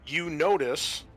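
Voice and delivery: usual speaking voice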